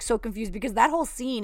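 ordinary delivery

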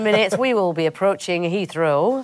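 high, loud northern English cabin announcement; northern pilot announcement. Acted. Pilot addressing passengers before landing, hammed-up northern accent. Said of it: "You never really hear that on a plane".

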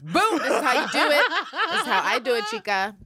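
brash showing off. Retold or imitated. Fernanda shows Pam how.

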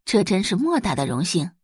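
ordinary delivery

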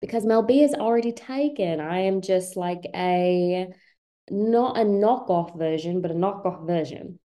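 measured mock British explanation; playful put-on Brit; fake British accent. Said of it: "with the British accent".

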